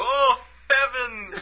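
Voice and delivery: high, slow prim gasp of "Oh, heavens"; a prim startled old lady exclaiming; mock surprise. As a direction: clutch your pearls, high and drawn out. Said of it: "I just go".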